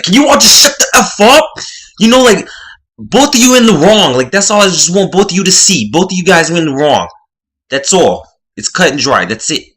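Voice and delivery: high, loud imitated Boston accent; mock Boston accent telling them off. Roommate telling the arguing pair off in a Boston accent. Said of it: "that Boston accent came out".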